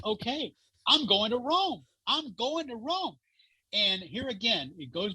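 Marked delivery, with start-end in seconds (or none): 0.0-3.2 excited. Retold or imitated. Excited at meeting the Pope.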